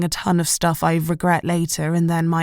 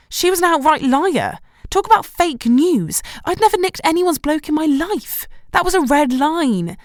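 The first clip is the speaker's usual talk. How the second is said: indignant; 8 semitones higher than usual. A narrator's reading. Indignant inner rant.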